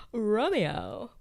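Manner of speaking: a hint of lovesick drama; mock swooning Romeo. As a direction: play it dreamy and theatrical. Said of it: "Why would you say it like that?".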